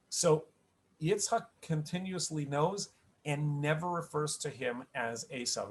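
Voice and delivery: ordinary talk, nothing marked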